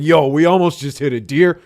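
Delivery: loud, high, excited retelling; excited blurting. Retold or imitated. Telling a friend about the near miss, excited blurting. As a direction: blurt the big news loudly.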